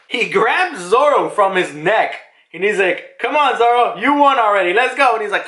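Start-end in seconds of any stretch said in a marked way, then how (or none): 3.2-5.1 high, insistent mock call; acted urging. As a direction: urge a friend away, retold with energy. Voices Jinbei urging Zoro on.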